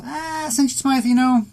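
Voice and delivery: lightly voiced doubtful listener. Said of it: "you're thinking to yourself".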